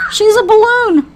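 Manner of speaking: high, loud gleeful exclamation; gleeful teasing. Acted. Onlooker sees her floating in air, high gleeful tease. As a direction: crow with delight at the sight. Another voice mocks floating narrator.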